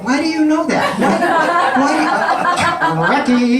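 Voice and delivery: incredulous and giggling; laughing disbelief. Real, not acted. Photographer teases his friend for knowing the tank, laughing. Teasing friend who knows Trek trivia.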